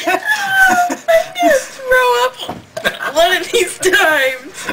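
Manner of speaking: laughing. Real, not acted. Hiccups, laughing and groaning.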